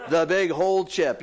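slow, relishing the last big chip; a dreamy, delighted hint of savoring. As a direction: savor each word like a treat. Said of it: "You're like".

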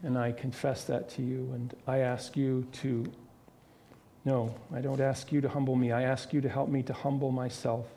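soft, low confessional prayer to God; a pastor's gentle closing prayer; quiet prayer. Acted. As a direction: pray it humbly, hushed and unhurried. Leads the congregation in prayer.

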